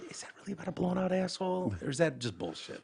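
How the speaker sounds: softly acted private question; hushed mock-discreet probe; confidential whisper. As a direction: ask it on the quiet, just between two people. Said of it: "huddle up, and just whisper".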